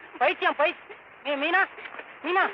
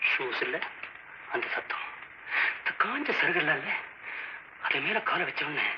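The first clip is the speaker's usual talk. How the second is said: spooky storytelling; 10 semitones lower, 9 dB quieter than usual. Acted. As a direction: draw the listener into dread. Horror story continues. (திகில் கதை சொல்லும் குரல்)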